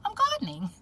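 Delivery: very high, small acted voice; high-pitched, playful girl's reply; gardener girl. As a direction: answer as an innocent gardener caught at work. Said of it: "And she's just like".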